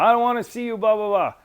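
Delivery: high, loud angry yell; chewing him out. Retold or imitated. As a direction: tear into the young sergeant. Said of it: "like, chewing my ass".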